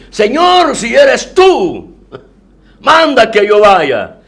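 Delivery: Peter calling out. Retold or imitated. Peter calls from the boat to Jesus, slow pleading. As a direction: call across the water, slow and pleading.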